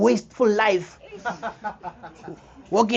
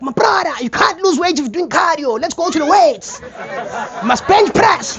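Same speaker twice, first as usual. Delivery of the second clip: high, loud know-it-all instructions; gym guy advice; 10 semitones higher, 7 dB louder than usual. Retold or imitated. Gym regular sending him to bench press, high and loud. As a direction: push him to the weights like a gym bro.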